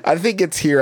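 ordinary talk

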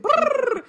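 a rapid high "Brrr" burst; fast rattling brrr. Retold or imitated. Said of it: "And it's like".

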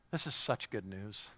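ordinary talk, nothing marked

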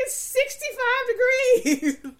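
high, slow, loud mocking of husband. Wife imitating husband insisting the room is cool, high and slow. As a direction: stretch it out, exasperated husband. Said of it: "He's like".

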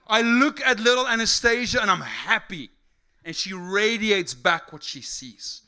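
loud, high, fervent sermon delivery; impassioned preaching. Real, not acted. Preacher telling congregation about shared joy, loud fervent preaching. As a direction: drive each phrase home to the congregation.

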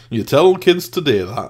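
mock Yorkshire accent, loud; hammed-up Yorkshireman grumble; Yorkshire old man. Quoting the Four Yorkshiremen skit in Yorkshire accent. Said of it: "Four Yorkshiremen skit from Monty Python".

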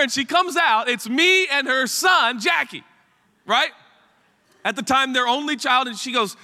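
ordinary talk

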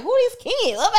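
mock alarm. Wife backing away from lost kids, in high mock alarm. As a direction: act out startled dismay up high. Acting out reaction to strange kids.

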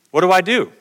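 ordinary talk, nothing marked

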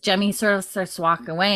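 normal talking voice

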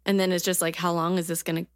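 ordinary talk, nothing marked

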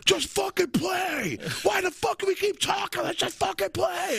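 high, slow, strained impatient yell; mock angry rant. Friend imitating Lenny, an impatient drummer, in a high mock rant. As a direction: ham up a furious drummer telling them to play. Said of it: "He's like".

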